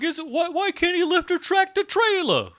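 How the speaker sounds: high whiny mock complaint; Bruce impression. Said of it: "That was a good Bruce impression".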